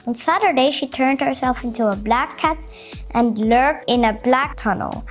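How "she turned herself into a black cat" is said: ordinary delivery, nothing marked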